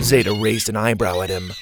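ordinary delivery, nothing marked

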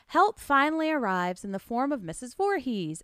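ordinary talk, nothing marked

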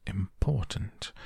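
soft, near-whispered musing to oneself; hushed, hesitant word-testing; undertone. A narrator's reading. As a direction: try out each word slowly under your breath. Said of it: "went on to himself in an undertone".

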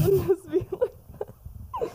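ordinary talk

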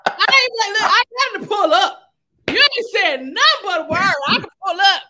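loud swaggering pimp talk. Pretend. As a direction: strut it like a flashy pimp. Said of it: "in my pimp voice".

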